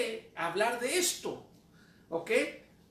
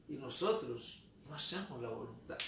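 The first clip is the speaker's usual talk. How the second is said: ordinary talk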